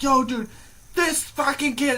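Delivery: high, loud call for attention; excited storytelling. Retold or imitated. Telling everybody at a party the story, excited. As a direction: grab the room's attention excitedly.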